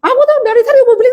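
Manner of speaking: high, rapid-fire mock scolding; imitated tirade, fast and shrieky; sharp nagging. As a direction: rattle it off like an exasperated mother. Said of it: "ngomelnya pedes gitu".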